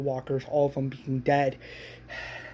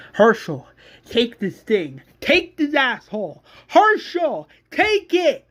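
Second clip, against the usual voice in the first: urgent yells climbing higher; acted screaming; 8 semitones higher, 5 dB louder than usual. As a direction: yell orders at someone nearby, escalating. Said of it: "as Rick's screaming".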